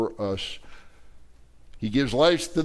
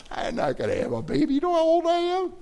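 put-on old wife in disbelief, jumping much higher at the end; incredulous Sarah. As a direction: scoff at the angel's news, voice flying up. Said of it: "she's like".

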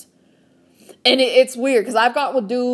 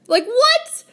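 high-pitched, disbelieving outburst; incredulous; 14 semitones higher, 4 dB louder than usual. Retold or imitated. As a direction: shoot up high in mock disbelief. Incredulous reaction to picture request.

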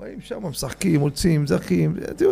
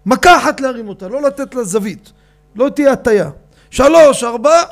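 loud commanding count; shouted orders; 6 semitones higher, 7 dB louder than usual. Retold or imitated. Organizer counting avreichim into the lift, loud command. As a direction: bark the count to the team.

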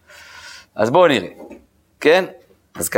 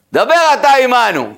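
high, drawn-out plea to a leader; acted urgent plea; 10 semitones higher, 4 dB louder, slower than usual. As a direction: plead it slowly, pitched well up. Acting out the people pleading to Moses. (תחינה נרגשת ומשוחקת)

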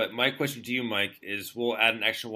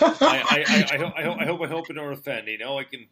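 laughing, much higher and louder attempt at an Irish accent; giggly put-on Irish accent, pitched way up; mock Irish accent; 6 semitones higher, 10 dB louder than usual. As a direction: ham up a bad Irish accent through giggles. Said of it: "I kinda tried my Irish accent".

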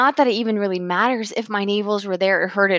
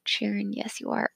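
much softer aside to herself; quiet aside; 10 dB quieter than usual. Real, not acted. Sharon admitting to herself she is proud, softly. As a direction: drop the voice for a teasing self-rebuke.

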